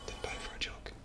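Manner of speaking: voiceless, hushed confession; whispered aside. Pretend. Owning up after the joke excuse, whispered aside. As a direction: whisper the truth under your breath.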